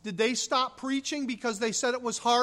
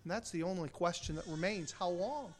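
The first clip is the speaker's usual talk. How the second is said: soft, fast, inward; quiet question; 3 semitones lower, 9 dB quieter, faster than usual. Real, not acted. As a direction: drop to a hushed reflection. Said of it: "quiet reflective close".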